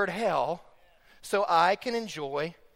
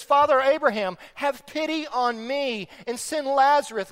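raised, pleading pulpit voice; crying out; 7 semitones higher, 5 dB louder than usual. Retold or imitated. Preacher voicing rich man pleading with Abraham, crying out. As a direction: call out for pity. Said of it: "he cries out to Father Abraham".

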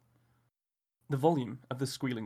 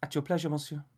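put-on French accent; 2 semitones higher than usual. A narrator's reading. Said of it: "a strange Frenchman".

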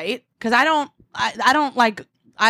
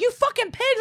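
screaming insult. Retold or imitated. Cyclist insults three guys on the sidewalk, mock screaming. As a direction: imitate screaming abuse in a high voice. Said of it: "I'm screaming at them".

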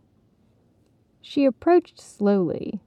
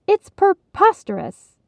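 much higher, indignant insistence; insisting; 11 semitones higher, 4 dB louder than usual. Acted. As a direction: insist it with outrage. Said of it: "Heidi insisted".

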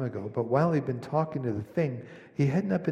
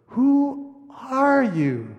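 put-on high, slow, awed boy's question; Shasta asks; 11 semitones higher, 8 dB louder, much slower than usual. A narrator's reading. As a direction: ask in wonder and a little fear. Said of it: "said Shasta".

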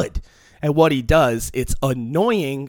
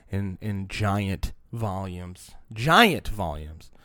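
slow emphasis; 7 semitones lower, 5 dB quieter, much slower than usual. Real, not acted. Repeats slowly for effect.

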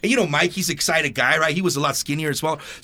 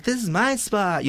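higher, slow mocking girl voice; imitating defiant girl; 4 semitones higher, 4 dB quieter, slower than usual. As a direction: mimic her drawing out every word.

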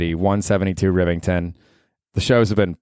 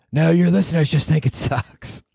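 high put-on girlfriend heckle; mock girlfriend; 9 semitones higher than usual. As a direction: needle him in a high mocking voice.

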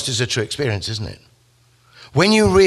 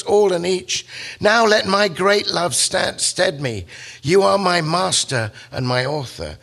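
awed worship; 8 semitones higher than usual. Acted. Dante hailing Virgil as his master, in high reverent awe. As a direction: lift the voice high in devotion.

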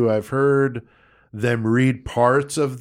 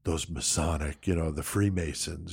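low, hushed conspiratorial imitation; hushed mock suspicion; 7 semitones lower, 11 dB quieter than usual. Podcaster mimicking people who talk about Freemasons, hushed and low. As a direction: drop it low and quiet, like gossip about a secret cult.

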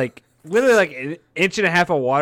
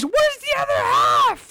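very high, slow shouted question; hammed-up shriek; mock shrill shout; 3 dB louder, slower than usual. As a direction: yell it like a shocked woman. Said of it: "She goes".

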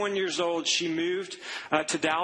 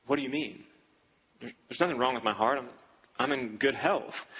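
somber; 6 semitones lower than usual. Retold or imitated. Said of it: "gets a somber face and says".

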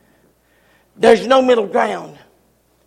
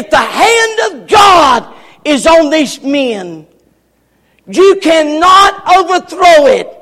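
rising, shouting preacher cadence; fiery preaching; 3 semitones higher than usual. Acted. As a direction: preach it up to a holler. Preaching at full force.

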